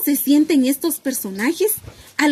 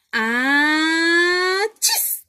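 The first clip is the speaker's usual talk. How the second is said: sneeze; 3 semitones higher than usual. Acted. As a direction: act out a big bear sneeze. Bear sneezes in story.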